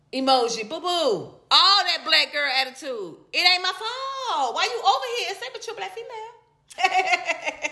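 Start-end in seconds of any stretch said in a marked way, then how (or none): 3.3-7.7 laughing taunt. Pretend. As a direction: taunt her playfully, laughing and high.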